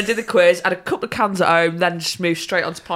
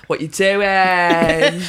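long, drawn-out question; imitated needy texter; 3 semitones lower, much slower than usual. Imitating the keen man texting Lauren, drawn out. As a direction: stretch the words out teasingly. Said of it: "he messaged Lauren and was like".

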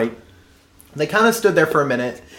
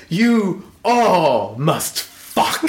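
very slow grand command; roaring tyrant king; 2 semitones higher, much slower than usual. Retold or imitated. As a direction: decree it like a king. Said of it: "it's like".

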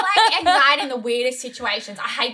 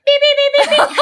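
high, loud, rapid beeping; imitated scanner beeps; 9 dB louder, faster than usual. Setting off the airport security scanner, mimicked alarm beeps.

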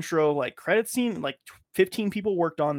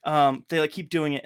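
ordinary talk

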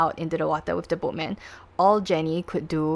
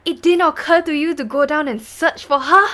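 loud, high, incredulous courtroom rebuke; strict judge; 9 semitones higher, 10 dB louder than usual. Acted. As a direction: fire the question at the accused, raised voice. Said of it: "like, very strict and then".

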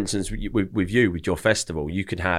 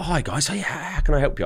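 higher-pitched eager host voice; imitating welcoming owner; 8 semitones higher than usual. Restaurant owner runs out to greet guests, mock-eager welcome. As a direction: greet guests in a high, put-on voice.

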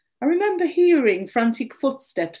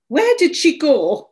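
higher, louder demand; 5 semitones higher, 5 dB louder than usual. A narrator's reading. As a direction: demand an answer right now. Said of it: "I demanded".

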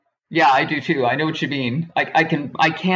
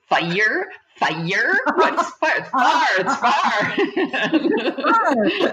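teasing uncle; 8 semitones higher, much slower than usual. Retold or imitated. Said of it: "he would go".

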